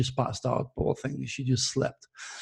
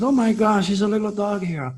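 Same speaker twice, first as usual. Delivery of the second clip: higher and louder, caught off guard; surprised wife; 6 semitones higher, 3 dB louder than usual. Retold or imitated. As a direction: gasp it out like finding a stray puppy at the door. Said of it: "She said".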